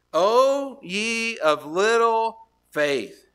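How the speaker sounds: Jesus rebuking gently, drawn out; slow solemn rebuke. Acted. Preacher voicing Jesus rebuking the fearful disciples. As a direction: stretch it out like a patient teacher.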